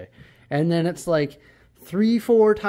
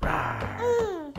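high little dinosaur rawr; squeaky toddler-style roar; playful monster growl; 4 dB quieter than usual. Pretend. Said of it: "what does the dinosaur say".

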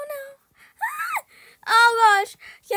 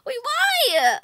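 startled; 7 semitones higher, 7 dB louder than usual. Real, not acted. Startled by the game.

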